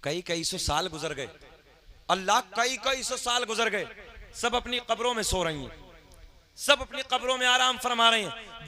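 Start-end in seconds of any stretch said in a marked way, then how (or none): 2.1-8.7 raised, high preaching voice; fervent sermon delivery. Acted. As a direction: proclaim it to the crowd.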